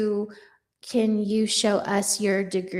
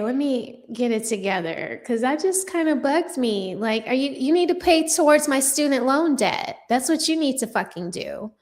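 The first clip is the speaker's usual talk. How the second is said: ordinary delivery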